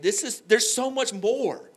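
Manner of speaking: high, slow, loud pleading; passionate plea. Retold or imitated. Urging fellow Christians, high slow passionate plea. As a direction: stretch it out with longing. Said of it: "I'm like".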